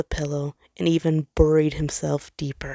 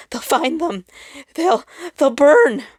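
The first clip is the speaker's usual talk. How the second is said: terror rising as he stumbles over the words; panicked fear; 9 semitones higher, 4 dB louder than usual. Acted. Panicked star fearing for children.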